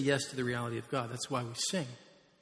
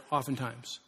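ordinary talk

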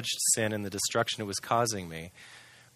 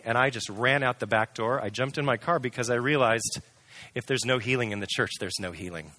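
usual speaking voice

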